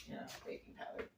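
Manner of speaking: soft whispered repeat, barely voiced; a quick under-the-breath whisper; whispered aside. Real, not acted. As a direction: drop to a whisper as if to herself. Muttered aside to herself.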